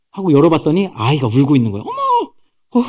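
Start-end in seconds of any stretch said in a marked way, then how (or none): 1.8-2.9 high, soft drawn-out gasp of surprise; acted high-pitched astonishment; startled exclamation. Princess finds a crying baby.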